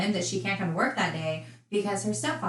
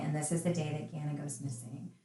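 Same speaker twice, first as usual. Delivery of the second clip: ordinary delivery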